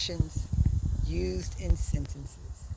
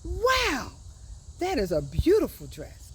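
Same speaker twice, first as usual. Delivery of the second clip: wow jumps high, then an admiring dress line; 7 semitones higher than usual. Shown as a demonstration. Teacher reads the example to the kids, admiring. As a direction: sound amazed by the dress. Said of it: "So that's how you'd read it".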